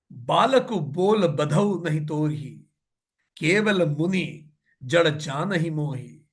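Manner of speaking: slow, measured verse chanting; verse recitation. A narrator's reading. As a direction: recite the scripture line with drawn-out syllables. (धीमा दोहा पाठ)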